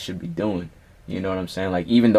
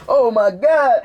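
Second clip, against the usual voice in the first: much higher, louder shocked gasp of words; 14 semitones higher, 6 dB louder, much slower than usual. Retold or imitated. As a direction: react to the tube in his body in horror. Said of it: "And I'm like".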